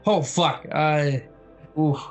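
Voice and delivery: ordinary talk, nothing marked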